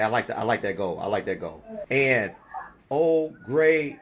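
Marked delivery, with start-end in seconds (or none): 0.0-1.6 low approval. Real, not acted. Host approving the new goal, lower and settling. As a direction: sink into a low satisfied nod.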